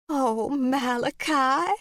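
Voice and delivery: high, slow, sweet aunt voice; sweet old aunt. Acted. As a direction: gush out a fond welcome. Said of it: "her welcoming smile soft and warm".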